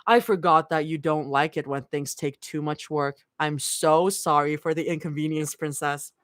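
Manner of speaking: low, bitter sarcastic apology; understated bitterness with an edge; edged mock apology, pitched low. A narrator's reading. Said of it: "bitterness that spews from her edged tone".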